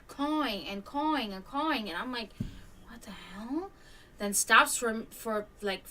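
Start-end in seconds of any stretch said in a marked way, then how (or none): 2.9-3.7 hushed, breathy disbelief under the breath; whispered what the hell. Retold or imitated. Telling viewers about the hawk, a stunned whisper. Reacting to hawk's cawing.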